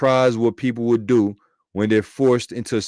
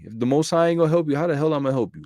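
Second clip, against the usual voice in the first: higher, fast, fed-up king brushing her off; mock-indignant king, quicker and higher; exasperated king; 5 semitones higher, faster than usual. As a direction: throw it back at her like a king out of options. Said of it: "And he said".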